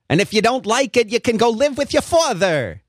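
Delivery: high-pitched impression of an exasperated mom; mom's voice. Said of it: "my mom might say it".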